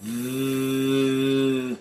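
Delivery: long steady buzz on "V"; sustained voiced TH hum on "V"; vibrating TH sound. Shown as a demonstration. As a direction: drone "V" like an electric mixer. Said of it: "uma batedeira".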